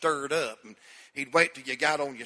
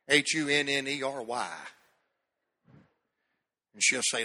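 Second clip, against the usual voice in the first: ordinary delivery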